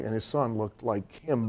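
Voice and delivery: ordinary delivery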